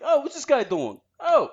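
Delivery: high-pitched Brooklyn caricature; Brooklyn passerby. Acted. Brooklyn guy heckling as he passes, high caricature accent. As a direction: toss it out in exaggerated Brooklyn. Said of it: "drives by or walks by and says".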